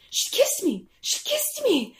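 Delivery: high-pitched thrilled crowing; mock giddy delight; giddy old man. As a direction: brag to the wife in a high squeal. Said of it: "he goes up to his wife, and he's like".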